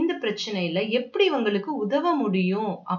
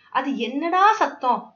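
slow, higher, annoyed question; irritated; 5 semitones higher, 4 dB louder, slower than usual. Acted. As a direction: drag out the question in mock annoyance. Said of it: "அவருக்கு ரொம்ப எரிச்சலா வந்தது".

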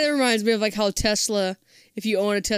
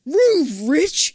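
mock yell; 6 semitones higher, 7 dB louder than usual. Playful horn yell.

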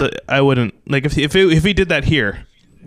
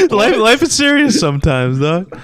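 laughing; 5 semitones higher than usual. Real, not acted. Host agreeing with co-host about life being serious, laughing. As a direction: talk through the tail of a laugh, higher.

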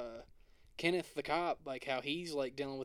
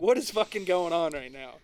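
louder, higher, disbelieving rant; mock outrage, pitched up and loud; baffled outrage; 4 semitones higher, 7 dB louder than usual. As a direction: play someone who can't believe what he's hearing. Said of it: "he's like".

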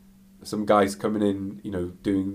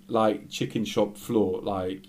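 ordinary talk, nothing marked

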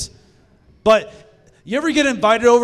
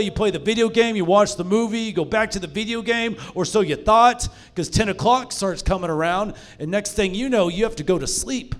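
ordinary talk, nothing marked

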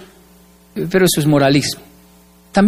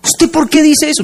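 suspicious wife; 8 semitones higher than usual. Retold or imitated. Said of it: "le dije a mi esposa".

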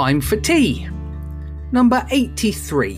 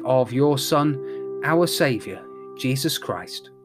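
prayer; 4 semitones lower, 7 dB quieter than usual. Acted. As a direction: lead the prayers gently. Leads intercessions in prayer.

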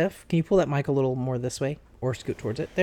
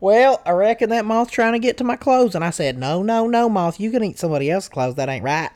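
higher, lively country twang; put-on country accent; 5 semitones higher, 5 dB louder than usual. Shown as a demonstration. Telling off a moth over clothes, country accent. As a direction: spin a tale in a hammed-up country accent. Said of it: "I talk like that sometimes".

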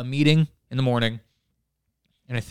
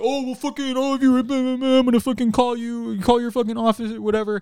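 much higher, whiny mock outrage; mocking the owner who yelled about parking, high and whiny; mock angry threat; 10 semitones higher than usual. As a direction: squeal the threat like a petty boss.

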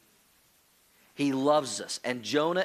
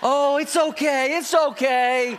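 put-on slow, soothing comfort for a little girl; drawn out, tender and reassuring; soothing it's okay; 8 semitones higher, 6 dB louder, slower than usual. Comforting daughter during spanking.

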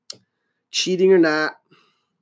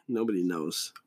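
much lower and softer, muttered; low mumble; 10 semitones lower, 10 dB quieter than usual. Acted. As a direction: let it sink low and quiet. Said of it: "I don't know why I'm talking like that".

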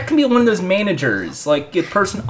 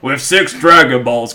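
ordinary delivery

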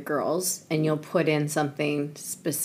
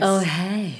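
sexy morning voice; 4 semitones higher than usual. Pretend. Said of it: "We sound like drag queens".

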